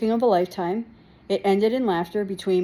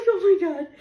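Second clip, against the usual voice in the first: giggling oh my God through laughter; laughing disbelief; 8 semitones higher, 4 dB louder than usual. Retold or imitated. Teen recalling her crash, laughing. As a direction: laugh it out in shock.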